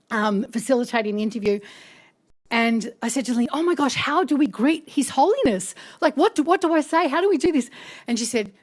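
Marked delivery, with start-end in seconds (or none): 3.5-7.7 higher-pitched mock fluster. Asking colleague how to greet His Holiness, flustered. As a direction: retell a mild panic over protocol. Retold flustered question before meeting.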